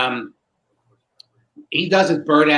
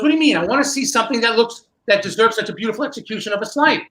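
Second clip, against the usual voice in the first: heated insistence; 7 semitones higher, faster than usual. Retold or imitated. Rene snapping back at Ascanio, high and heated. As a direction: argue it up high like you can't believe him. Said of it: "Rene turns and goes".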